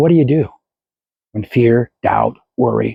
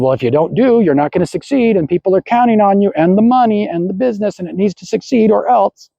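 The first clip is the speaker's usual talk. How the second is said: rising, higher-pitched mock worry; mind going wild; 7 semitones higher than usual. Mind warning against not acting, mounting mock panic. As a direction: pile up the worries with mounting urgency. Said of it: "your mind goes wild".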